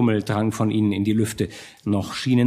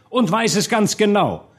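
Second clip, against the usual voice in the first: high, emphatic assertion; solemn proclamation; 8 semitones higher, 3 dB louder than usual. A narrator's reading. Orator answers his challenger, solemnly proclaiming. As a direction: declare it grandly to the crowd. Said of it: "sprach der Redner mit feierlicher Stimme".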